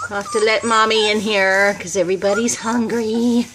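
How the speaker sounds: ordinary talk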